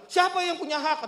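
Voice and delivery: high-pitched mock indignation; retold angry challenge. Angry neighbour challenging the homeowner over the parking spot, retold indignation. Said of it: "dia lebih marah".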